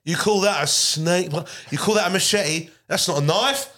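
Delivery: put-on jokey Australian movie-hero accent; mock Crocodile Dundee Australian. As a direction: sneer at a small knife in an Australian accent. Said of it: "What kind of Australian accent was that?".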